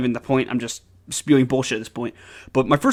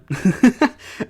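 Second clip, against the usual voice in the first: quick high laugh; brief amused chuckle; short laugh; 10 semitones higher, 5 dB louder than usual. Real, not acted. Laughs at own story.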